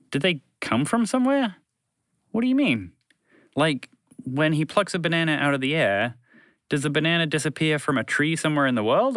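plain reading voice

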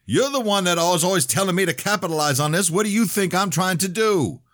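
very high, loud, heated retort. A narrator's reading. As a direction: outdo the other person's yelling. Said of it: "Her yelling only made me louder".

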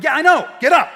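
put-on shouted urging at ten years old; kid's shout. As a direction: yell him back onto his feet. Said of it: "I'm like".

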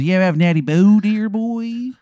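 over-the-top Maryland voice; [name]'s Maryland accent. Acted. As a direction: announce yourself in a horrible Maryland accent. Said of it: "his accent is horrendous".

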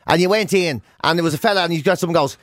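ordinary talk

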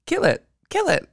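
high, urgent kill it; mock panicked bellhop yelling. Bellhop urging her to kill the cockroach, mock panic. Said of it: "the bellhop was like".

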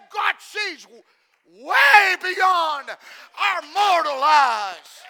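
loud, very slow, stretched out; full-voice drawn-out preaching; preaching shout. Real, not acted. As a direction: shout it slowly to the back row.

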